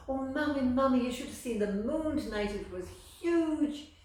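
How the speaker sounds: put-on high, loud, eager little boy; bursting to tell his mammy; excited child. Little Amal excitedly telling his mammy about the moon.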